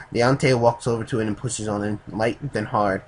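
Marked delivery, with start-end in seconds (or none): none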